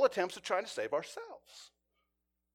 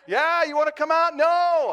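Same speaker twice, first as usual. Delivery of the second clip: higher and louder, called back and forth; shouted exchange; 9 semitones higher, 11 dB louder than usual. Retold or imitated. Negotiator and barricaded man calling through the door, loud. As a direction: toss the lines across the distance.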